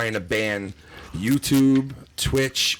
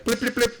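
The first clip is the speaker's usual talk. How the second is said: high little blip blip sounds; chirpy mock pager beeps; imitated beeping; 11 semitones higher than usual. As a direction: mimic the phone chirp, pitched up. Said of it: "it'd be in my pocket here, like".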